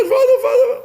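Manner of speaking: very high, loud panicked cry for father; frightened child. Acted. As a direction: scream for dad as the dogs come. Boy cries out as dogs attack.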